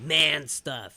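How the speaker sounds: teasing caveman enthusiasm, woo; mock macho. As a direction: play up the manly excitement for laughs. Mocking macho testosterone scene.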